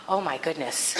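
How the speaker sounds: retold gasp, then a low, quiet reaction; dismayed gasp. Telling listeners about an unwelcome revelation in class, dismayed. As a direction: react with a sharp intake, hushed. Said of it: "and I went".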